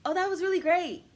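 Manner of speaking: high, loud, over-the-top gushing; mock ego-stroking rave, big and bright; gushing praise. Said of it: "ego coaxing".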